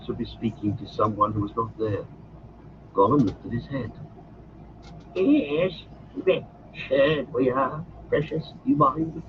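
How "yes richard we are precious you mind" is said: high, hissing creature voice, pleading; Gollum's voice. Acted. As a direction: wheedle like a wretched creature. Gollum speaks to Frodo.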